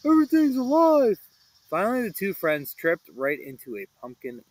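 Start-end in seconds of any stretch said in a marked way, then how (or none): 0.0-1.2 high loud panicked yell; Shaggy shrieking in panic. Acted. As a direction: shriek it in terror. Shaggy panics among vines.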